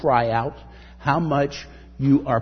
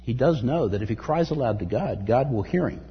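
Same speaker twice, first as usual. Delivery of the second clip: usual speaking voice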